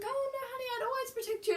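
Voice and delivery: high, soft, cooing mum imitation; soothing mum. Imitating mum reassuring her daughter, high soothing voice.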